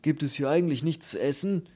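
lightly voiced fussy detainee; grumbling little man. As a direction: complain indignantly about the lack of food. Bald man complains about food.

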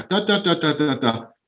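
put-on high sing-song baby babble, pitched well up; baby babbling. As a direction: babble like an infant in the babbling phase. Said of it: "in der zweiten Lallphase".

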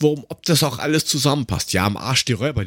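ordinary talk

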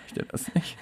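soft breathy whisper; whispered refusal. Pretend. Introducing the next segment, a whispered gag. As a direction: whisper it like a jingle.

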